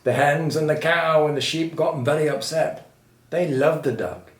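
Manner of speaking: ordinary delivery, nothing marked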